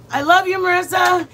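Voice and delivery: ordinary talk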